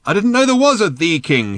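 high, loud exasperated yell; high-pitched shouted protest; shouting. A narrator's reading. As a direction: shout it over the din, stressing "the". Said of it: "shouted Ford".

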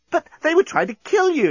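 very high protest; incredulous exclamation. A narrator's reading. Nettie protesting at the apology, exclaiming. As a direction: exclaim in disbelief. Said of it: "exclaimed Nettie".

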